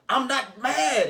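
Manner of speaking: yelling. Retold or imitated. Arguing man shouting that he isn't mad, loud and slow. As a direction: bellow that you're not mad, clearly furious. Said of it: "now you're yelling".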